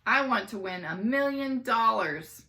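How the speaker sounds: put-on a kid shouting with excitement. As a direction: shout it to the whole room. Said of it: "Michael shouted".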